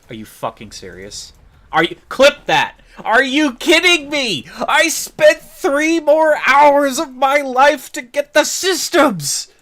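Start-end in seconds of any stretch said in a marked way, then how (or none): 1.7-9.5 screaming in real outrage, high and loud; furious rant. Real, not acted. Streamer raging at his chat over a wasted farm. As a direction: yell every word in disbelief.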